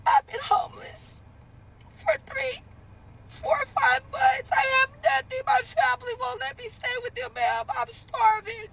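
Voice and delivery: put-on desperate voice; fake pitiful plea. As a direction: beg like you're starving. Acted pitiful plea on phone.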